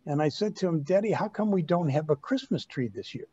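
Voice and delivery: usual speaking voice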